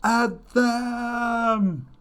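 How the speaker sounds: huge high bellowing war cry; roaring tyrant king. Retold or imitated. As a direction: bellow the charge like an emperor. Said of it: "the Emperor bellowed".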